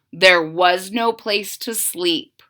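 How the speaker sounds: ordinary delivery, nothing marked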